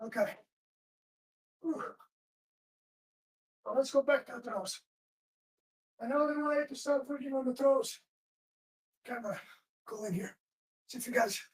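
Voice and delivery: breathless after drilling; out of breath. Real, not acted. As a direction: speak while still catching your breath. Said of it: "I catch my breath".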